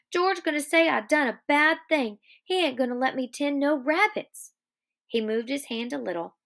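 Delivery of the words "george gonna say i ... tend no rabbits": high, anxious pleading; worried childlike plea; begging. Acted. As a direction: fret aloud about getting in trouble. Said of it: "he begged".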